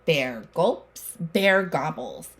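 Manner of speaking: usual speaking voice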